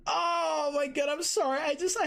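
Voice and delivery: overwhelmed squeaky delight; squealing fangirl. Real, not acted. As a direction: gush and squeal, heart melting. Fangirling over Kushina's blush.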